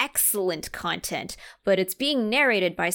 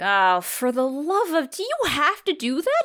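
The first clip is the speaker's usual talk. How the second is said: exasperated husband; 7 semitones higher, 4 dB louder than usual. Retold or imitated. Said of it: "And he's like".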